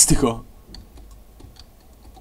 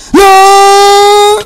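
shout; 15 dB louder than usual. Real, not acted. Loud cry in game.

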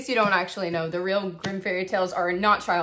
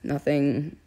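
dramatic confessional monologue; 5 semitones lower, 6 dB louder than usual. Acted. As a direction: deliver it like a moody film narration. Mock-diary monologue continues.

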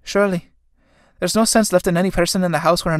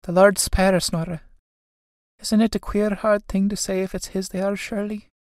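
hushed dread; 5 semitones higher than usual. Acted. Sisters examine drowned man's clothes.

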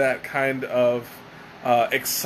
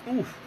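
strained groan of back pain; pained oof; 9 semitones higher, 7 dB quieter than usual. Real, not acted. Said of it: "Making old man noises".

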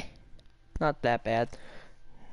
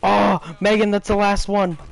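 excited; 6 semitones higher, 7 dB louder than usual. Real, not acted. Urgent team callout.

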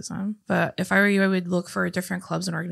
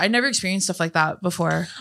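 normal talking voice

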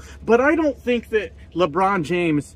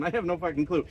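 usual speaking voice

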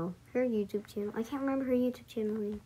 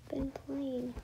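normal talking voice

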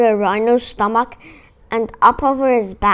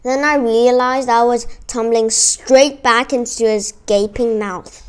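ordinary delivery

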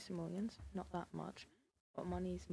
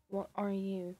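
startled outburst; 9 dB louder, slower than usual. Real, not acted. Baffled by baby's gender.

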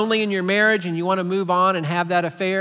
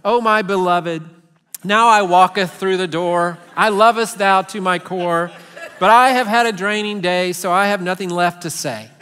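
louder, hammed-up old-fashioned love verse; mock archaic romance; 5 dB louder than usual. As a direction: recite like a pompous courtly lover. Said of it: "a language that I don't really even talk in".

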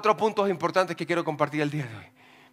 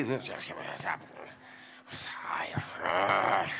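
breathy whispered whining, much lower and softer; whiny mock lament; 12 semitones lower, 11 dB quieter than usual. Preacher mimics an uncomfortable son-in-law, whimpering softly. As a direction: sigh it out like a man stuck with his mother-in-law.

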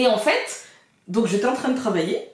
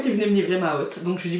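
normal talking voice

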